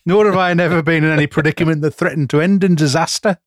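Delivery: hammed-up Staffordshire accent. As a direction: put on a broad Staffordshire voice. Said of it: "That's how he would speak".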